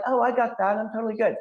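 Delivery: put-on high, cheerful, know-it-all kid voice; breezy kid reassurance. Boy brushing off his dad's warning in a high, breezy voice. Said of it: "And I'm like".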